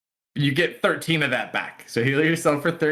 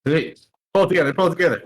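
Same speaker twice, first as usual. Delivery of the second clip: higher, fast urging; urgent pep talk; 5 semitones higher, 4 dB louder, much faster than usual. Real, not acted. Game master rallies a player who just missed, urgent pep talk. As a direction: urge them on quickly and firmly.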